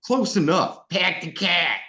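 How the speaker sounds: high, hammed-up Boston accent with no r sounds; mock Boston accent. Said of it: "That doesn't sound Boston".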